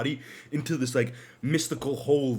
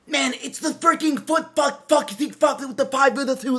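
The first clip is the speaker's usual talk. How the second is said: mock freak-out; 13 semitones higher, 4 dB louder than usual. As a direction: imitate an outraged car nerd losing it. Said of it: "freaking the fuck out right now".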